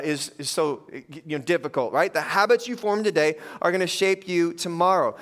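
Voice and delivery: normal talking voice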